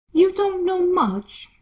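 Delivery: high, loud, slow put-down; rude Duchess. Acted. Duchess scoffing at Alice, high and loud. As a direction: sneer it down at her.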